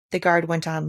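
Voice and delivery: ordinary delivery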